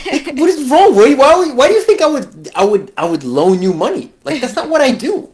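hammed-up incredulous rant; indignant disbelief. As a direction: act out an exasperated scolding. Voicing Wade scolding over a loan.

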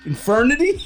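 amused surprise. Real, not acted. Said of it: "till infernity".

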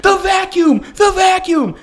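very high, exasperated shout; high-pitched mock-frantic yelling; retold screaming. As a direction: re-enact screaming a correction at your son. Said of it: "I'm screaming at him".

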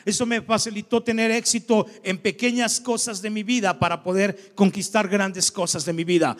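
ordinary talk, nothing marked

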